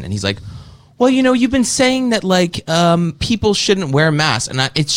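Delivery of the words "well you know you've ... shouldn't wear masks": high, slow whiny mock voice; mocking high voice of the host. Guest mocking the host's mask accusation in a high voice. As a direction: squeak out the accusation like a prissy parody. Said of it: "do I sound like that?".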